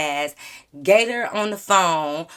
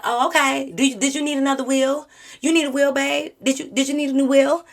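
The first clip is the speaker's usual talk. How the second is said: higher sing-song taunt, babe; mock sweetly about the wheel; 6 semitones higher than usual. Taunting Gator from her car, higher sweet mockery. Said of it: "I'm like".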